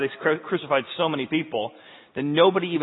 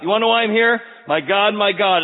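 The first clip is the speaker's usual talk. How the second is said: high, loud, insistent call; Jesus crying out; 5 semitones higher, 5 dB louder than usual. Acted. Jesus standing on the cross to the people, crying out. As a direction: shout it out to onlookers. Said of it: "crying out".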